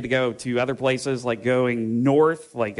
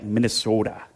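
put-on Minnesota accent. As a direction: stretch the O like a Minnesotan. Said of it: "the O's get a lot longer".

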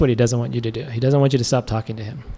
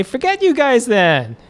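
a hint of a higher, slow brush-off; mock dismissive scold; 10 semitones higher, slower than usual. Preacher imagining God scolding Israelites, mock dismissive. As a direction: pretend God giving up on them. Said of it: "Does he say".

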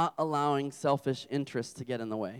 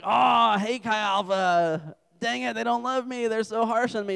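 higher, drawn-out whine; hammed-up hurt protest, raised pitch; mock complaint; 6 semitones higher than usual. Student griping about the small group leader, mock whining. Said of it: "And you're like".

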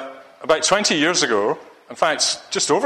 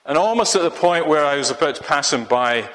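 normal talking voice

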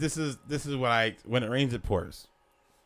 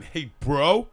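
higher, louder meathead bro; Jersey Shore bro caricature; 5 semitones higher, 4 dB louder than usual. Shown as a demonstration. Showing his partner the Jersey Shore bro, pitched up and loud. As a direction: pump it up like a Jersey Shore guy. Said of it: "Nick Kroll caricature of Jersey Shore".

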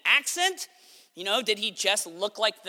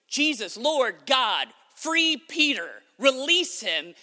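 imitated loud, pleading prayer; fervent prayer; 4 semitones higher, 6 dB louder, slower than usual. Gathered church begging God to free Peter, praying fervently. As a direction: raise it high and loud like a praying crowd. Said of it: "and they're praying".

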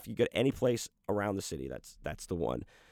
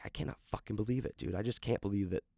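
retold sulky muttering, quick and quiet; stewing; 8 dB quieter than usual. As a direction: grumble it quietly to yourself. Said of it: "I'm still stewing on this thing".

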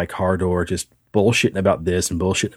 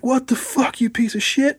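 much higher, mock furious yell; outraged dude. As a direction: shriek it like the guy whose new shoes got puked on. Dude sees puke on shoes.

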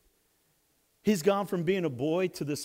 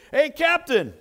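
loud, eager hail; eager retold greeting; excited; 8 dB louder, slower than usual. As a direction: call out to the captain, excited. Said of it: "He's so excited".

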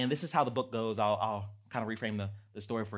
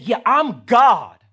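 slow, much louder proclamation; proclaiming; 10 semitones higher, 20 dB louder, much slower than usual. Acted. Voicing God to the congregation, slow loud proclamation. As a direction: ring it out with full weight. Preaching Christ's words.

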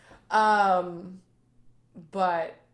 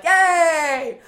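high, loud, drawn-out cheer; mock cheer; 10 semitones higher, 12 dB louder than usual. Arriving in heaven where friends are, mock cheer. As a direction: stretch out a gleeful pretend hooray.

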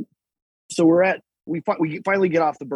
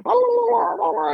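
high, loud gibberish yell in mock Arabic; 3 dB louder than usual. Customs official yelling at the sergeant, high mock gibberish. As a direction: blurt out angry-sounding nonsense syllables, high up. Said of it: "he's, like, yelling in Arabic".

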